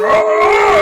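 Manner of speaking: high, loud strained grunt; straining grunt. Acted. GM voicing the wrestler straining as he's pushed back. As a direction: push against the arm with a grunt. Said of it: "He goes".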